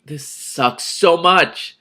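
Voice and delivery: loud, high, slow exasperated cry; frustrated outburst. Real, not acted. Reader's outburst at curse.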